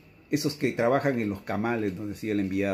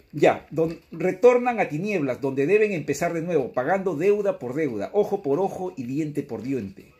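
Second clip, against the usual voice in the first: raised, higher formal reading of the text; reading scripture; 5 semitones higher, 4 dB louder than usual. Acted. Preacher reads the text to the congregation, in a raised formal voice. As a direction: read it out like a prophecy to the congregation.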